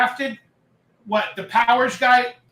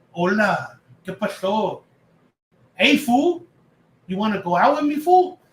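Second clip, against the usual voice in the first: lightly put-on Mexican accent; mock Mexican accent. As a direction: ham up a silly Mexican accent. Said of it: "ridiculous Mexican accents".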